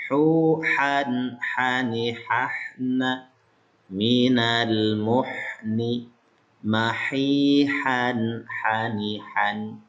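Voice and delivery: Minal muhni drawn out very slowly, ha pronounced from the throat; slow Arabic recitation. Shown as a demonstration. (bacaan lambat dengan ha dari tenggorokan)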